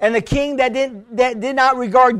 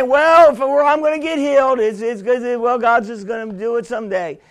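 laid back; 3 semitones lower, faster than usual. Acted. Preacher mimics believers too relaxed in their faith, laid back. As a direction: let it slide down in an easygoing mumble. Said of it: "too laid back in our faith".

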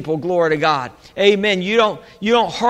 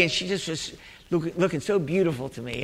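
softer, lower, hushed and fond; tender; 4 semitones lower, 10 dB quieter than usual. Retold or imitated. Preacher fondly recalling his wife to the congregation, tender. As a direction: drop your voice in tender admiration.

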